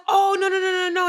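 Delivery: imitating a high, loud protest; mock frantic denial. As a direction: backpedal in a rush of high-pitched noes. Voices the owner backpedalling.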